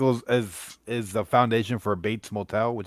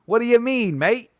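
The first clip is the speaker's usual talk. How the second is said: a light put-on Australian twang, higher; mock Aussie accent; 11 semitones higher than usual. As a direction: ask it like a jokey Aussie, pitch up. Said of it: "don't kill the accent".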